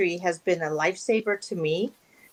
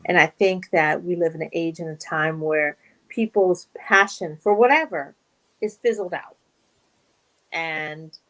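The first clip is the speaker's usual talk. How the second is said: usual speaking voice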